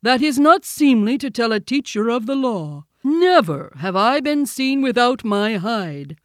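high, loud bear reprimand; loud, stern rebuke; severe scolding. A narrator's reading. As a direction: scold the pupil like an offended teacher. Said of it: "Baloo said severely".

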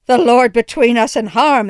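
loud, frightened cry to the Lord; alarmed exclamation. A narrator's reading. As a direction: blurt it out in fright. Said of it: "exclaimed my mother".

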